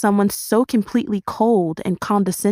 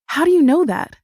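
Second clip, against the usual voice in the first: insistent; 8 semitones higher, 4 dB louder than usual. A narrator's reading. Insistent repeat of cut-off question.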